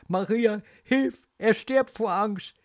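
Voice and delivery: desperate little prayer; frightened plea. Acted. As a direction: beg Maria to save the child.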